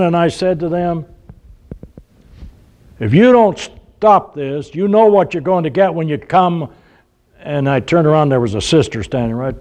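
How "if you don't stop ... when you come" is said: stern father's warning. Retold or imitated. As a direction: re-enact a dad laying down the law. Father threatening kids in the car.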